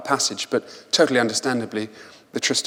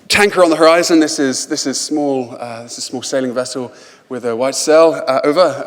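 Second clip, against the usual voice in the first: radio hail; 3 semitones higher, 7 dB louder than usual. Retold or imitated. Hailing a tanker by radio.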